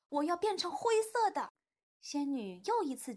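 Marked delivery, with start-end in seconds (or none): 0.1-1.5 high-pitched insistent demand; raised, demanding little-character voice; crying out. A narrator's reading. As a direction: call out what you want like a child. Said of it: "哈瓦尔叫道".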